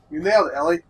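normal talking voice

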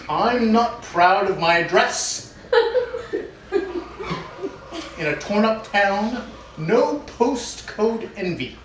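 slow, drawn-out lines, laughing through them; half-laughing, overly slow solemn reading; mock dramatic reading through giggles. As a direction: try to stay dramatic but keep cracking up. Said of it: "we have a dramatic reading".

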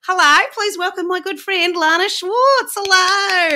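a playful put-on Australian accent with a drawn-out "Melbourne"; mock Australian accent. Host greets Australian guest in a mock Australian accent. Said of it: "my Australian accent's so good, isn't it?".

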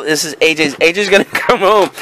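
ordinary talk

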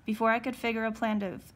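ordinary delivery, nothing marked

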